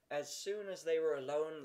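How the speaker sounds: usual speaking voice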